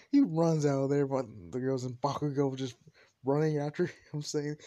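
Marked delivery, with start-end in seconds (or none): none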